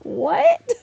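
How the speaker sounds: very high, loud, rising squawk; mock astonishment. Reacting to uncle's Bachelorette news, in high mock astonishment. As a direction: blurt it in exaggerated disbelief. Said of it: "I'm like".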